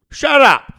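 high, loud snap; angry shout. Retold or imitated. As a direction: tell them off hard. Angry retort in retold scene.

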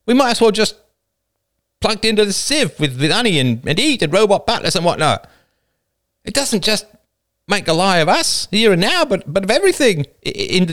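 high, impassioned tirade; urgent speech that stumbles into stammering at the end; wide-eyed alarm. A narrator's reading. Woman warns her people this is the end, wide-eyed. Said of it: "A wide-eyed woman said".

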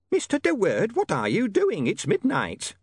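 high, scolding landlady voice; a prim landlady scolding a lodger; shrill landlady. A narrator's reading.

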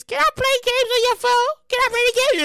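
put-on pleading child voice; whiny kid. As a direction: beg like a little kid. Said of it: "you know the kids".

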